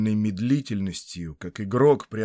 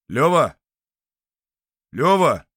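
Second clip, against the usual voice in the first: calling out; 5 semitones higher, 9 dB louder than usual. Acted. Calling the fallen friend.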